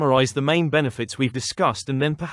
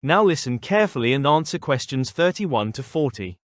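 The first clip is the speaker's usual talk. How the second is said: lecturing. Acted. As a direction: clear formal test announcer. Reads exam instructions.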